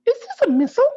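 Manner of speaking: tiny very high squeaky can voice; Baby Can voice. A narrator's reading. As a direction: sound like a small innocent can asking. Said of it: "I should do a Baby Can voice".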